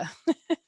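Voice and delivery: a quick high laugh; brief amused laugh burst; short laugh. Real, not acted. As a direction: laugh at the memory. Laughs at her own story.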